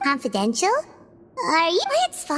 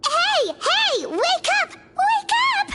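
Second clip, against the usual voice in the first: staged alarm; 11 semitones higher, slower than usual. Acted. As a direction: play-act shaking a fainted friend awake. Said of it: "that act just to scare a kid".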